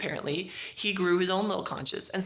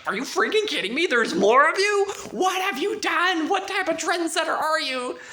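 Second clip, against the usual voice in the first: higher, louder, slowed exasperated outburst; outraged disbelief; 10 semitones higher, 7 dB louder than usual. Retold or imitated. Julie learning there are more zombies, outraged and loud. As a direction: blow up in disbelief at him.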